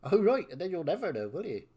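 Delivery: taunting West Country villain. Acted. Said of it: "doing a villain voice in West Country".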